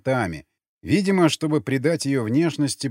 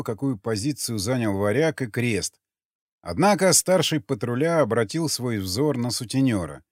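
ordinary delivery, nothing marked

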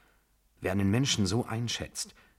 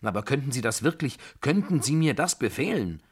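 quicker, rising in pitch and force; agitated challenge; 6 semitones higher, 4 dB louder than usual. Acted. Narrator asks whether she could order a killing, agitated. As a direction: fire it back, incredulous and urgent. Incredulous challenge after shouted vow.

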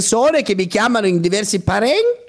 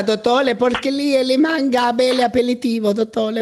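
high mock Chinese accent, l for r; hammed-up Chinese accent, pitched up; 7 semitones higher than usual. Caller mimics foreign speech.

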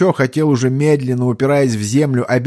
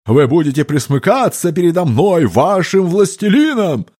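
gurgling alien voice; 6 semitones higher than usual. A narrator's reading. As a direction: boom it like a mad monster emperor. Monster demands worship. (булькающий голос чудовища)

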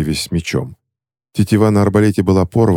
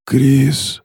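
hoarse; 7 semitones higher than usual. Acted. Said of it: "прохрипел он".

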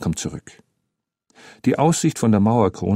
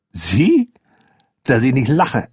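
higher-pitched incredulous scoff; scornful disbelief; 4 semitones higher, slower than usual. Acted. Boy mocking the adult's offer to climb, scornful. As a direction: scoff at a ridiculous boast.

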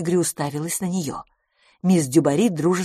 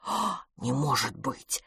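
soft, slow, lower disbelief; amazed gasp; 4 semitones lower, 8 dB quieter, slower than usual. A narrator's reading. Miss Pettigrew hears of the new nose, softly amazed. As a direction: gasp it out under the breath. Listener amazed at surgery news.

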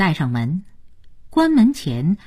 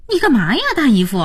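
high, protesting cry; upset, protective; 10 semitones higher, 4 dB louder, much faster than usual. A narrator's reading. Niece objects to uncle hitting the boy, upset. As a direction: protest in a raised, pained pitch. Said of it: "佳音心疼了".

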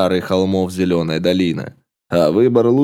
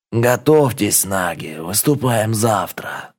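serpent chief voice. Acted. Chief Raga rallies warriors.

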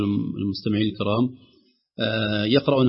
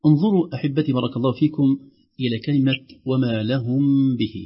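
usual speaking voice

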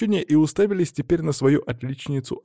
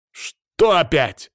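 loud, drawn-out, exasperated; annoyed snap, stretched out; irritated bark; 5 semitones higher, 5 dB louder, much slower than usual. A narrator's reading. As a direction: bark it with raised pitch. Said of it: "рявкнул я раздражённо".

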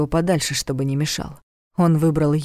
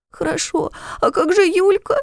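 put-on high, frightened child voice; pleading boy; 13 semitones higher than usual. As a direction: plead like a scared little boy.

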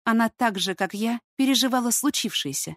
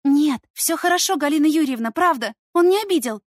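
put-on high, raised, anxious girl's voice; agitated girl; 8 semitones higher, 6 dB louder than usual. A narrator's reading. Stepdaughter assures the mother he didn't hurt her, agitated. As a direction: reassure urgently in a high, worried voice. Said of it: "Негромко и взволнованно".

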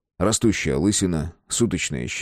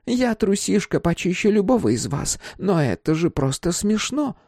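high-pitched woman's voice; high voice of a nervous lady; timid woman; 11 semitones higher than usual. A narrator's reading. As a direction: play a self-confessed scaredy-cat, pitched high.

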